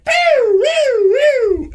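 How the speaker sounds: very high, loud siren whoops; police siren imitation. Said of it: "and I hear sirens".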